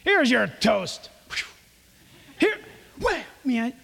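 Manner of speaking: mock waitress serving by obligation; bored waitress. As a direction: toss the toast down and snap at the customer. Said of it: "waitress is ministering to you by obligation".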